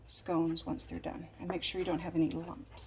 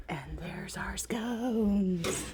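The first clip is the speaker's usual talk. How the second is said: hushed, slow delighted whisper; much slower than usual. Real, not acted. Scones out of the oven.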